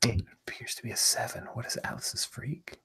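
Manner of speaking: unvoiced whispered aside; whispering. Real, not acted. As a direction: whisper it off-mic. GM asks player aside quietly.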